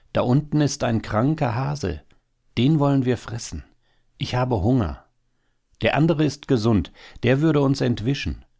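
high-pitched, urgent predator; hungry hawk, eager. A narrator's reading. Hawk to his wife about the sick hare below, eager.

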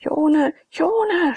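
passionate cry. A narrator's reading. Abakes cries out to Jone, loud and passionate. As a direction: call her name out passionately. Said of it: "schrie Abakes leidenschaftlich".

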